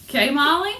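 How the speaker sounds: high, loud sing-song call; playful call. Real, not acted. Calling to viewer Molly.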